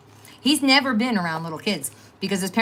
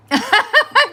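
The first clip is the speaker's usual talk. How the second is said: big laugh; 12 dB louder than usual. Real, not acted. Laughing at Peggy's comment.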